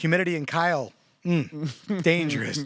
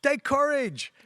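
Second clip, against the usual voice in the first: put-on very high Liberian-accented call; 11 semitones higher, slower than usual. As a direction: imitate a Liberian student's rallying shout. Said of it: "He's like".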